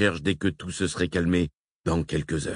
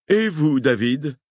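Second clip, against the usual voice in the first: Hillman interpelle; 8 semitones higher, 5 dB louder, slower than usual. A narrator's reading. Hillman asks.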